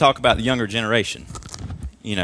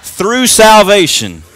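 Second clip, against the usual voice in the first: slow, much higher and louder declaration; shouted confession; 6 semitones higher, 8 dB louder, slower than usual. Shown as a demonstration. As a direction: proclaim it with full conviction. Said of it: "I want you to say it like you mean it".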